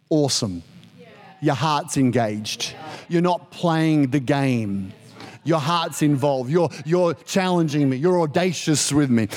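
voice of God. Acted. God voiced replying to Abram.

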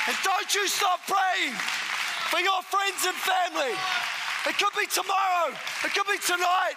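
preacher urging the church on; full-force preaching. Acted. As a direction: press the congregation to keep praying.

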